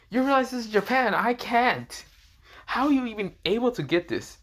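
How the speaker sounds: put-on high-pitched girlish protest; exasperated girl. A narrator's reading. Exasperated character protests in acted dialogue.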